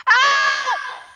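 sudden high loud scream; real startled yell; scared scream. Real, not acted. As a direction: shriek in fright. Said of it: "My scream".

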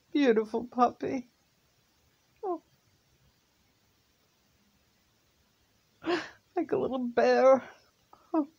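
high, aching fondness for the puppy; tearful. Real, not acted. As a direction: mourn the puppy tenderly. Remembering the lost puppy.

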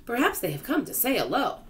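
high-pitched, lilting character voice; story character. A narrator's reading. Character guesses the fish came visiting, high lilt.

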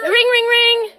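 high sing-song ring ring; phone ring. Pretend. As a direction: play a ringing phone.

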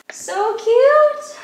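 high, loud, gushing; high loud squeal of delight. Real, not acted. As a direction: squeal it at the tiny spoon. Reacting to baby spoon set.

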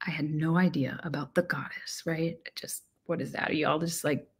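soft confiding whisper; hushed, barely voiced admission; whispered aside. Real, not acted. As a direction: drop to a whisper as if sharing a secret. Confiding aside about the goddess.

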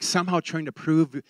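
ordinary talk, nothing marked

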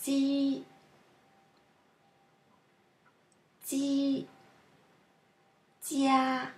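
Ji and jia held long on a level pitch; sound demo. Shown as a demonstration. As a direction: sustain Ji, ji, jia separately, evenly. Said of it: "a few sounds which are different".

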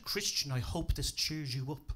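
ordinary talk, nothing marked

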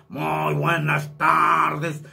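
slow pompous Calibre. Shown as a demonstration. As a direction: boast like a swaggering macho. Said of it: "así es como interpreto el calibre".

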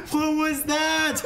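high, stretched shriek into helpless laughter; high, gleeful astonishment; laughing shock. Real, not acted. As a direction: cry out in disbelief, cracking up. Shocked by game moment.

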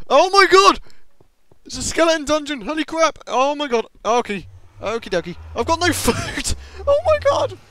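high, loud frightened exclamations; frantic loud scared outburst; panicked yelling. Real, not acted. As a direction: yell in real panic at the skeletons. Panicking at skeleton dungeon.

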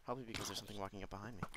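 dry self-answer. Real, not acted. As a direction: answer your own question drily, fast. Answers own question drily.

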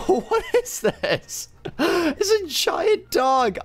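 amazed squeal. Real, not acted. Sees giant dog avatar.

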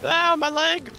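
high mock pirate wail. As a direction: shriek it like a silly pirate. Mocking pirate cry.

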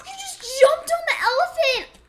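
high laughing squeal; shrieking with shocked laughter. Real, not acted. Friend escapes on elephant.

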